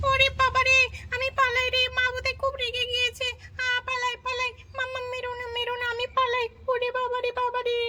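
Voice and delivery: put-on a little girl running from a beating; mock terrified child fleeing; panicked child. Child fleeing angry mother.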